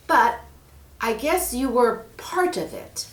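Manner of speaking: put-on girl Mary. Mary asks the snake for help, eager. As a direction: ask sweetly and eagerly.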